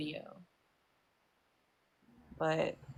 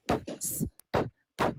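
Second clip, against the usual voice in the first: mock popping and hissing mouth noises; imitated mic spitting; faster than usual. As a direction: spit little plosives into the microphone. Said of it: "Drop Queen be spitting on us".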